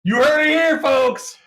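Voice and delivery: high mock presenter call; announcer voice. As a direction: announce it like a TV host.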